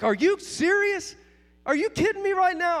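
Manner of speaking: high, loud imitation of a stunned pastor; retold disbelief. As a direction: play back the friend's amazed reaction. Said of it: "he was just in disbelief".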